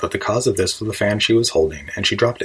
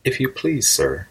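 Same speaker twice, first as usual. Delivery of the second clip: timid little request, opening higher then dropping; 5 semitones higher, slower than usual. A narrator's reading. Desperate Alice asking the passing rabbit for help, timidly. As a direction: ask politely, a touch timidly. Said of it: "in a low, timid voice".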